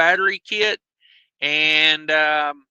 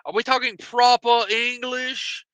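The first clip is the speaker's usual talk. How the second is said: hammed-up proper English accent; stagey fake British accent; mock British accent; 3 semitones higher, much slower than usual. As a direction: put on a bad, drawn-out British accent. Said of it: "I go proper English on 'em".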